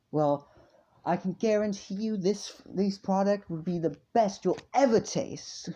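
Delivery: high, loud sales pitch; boastful salesman. Acted. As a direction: sell it hard like a market trader. Seller pitches his goods.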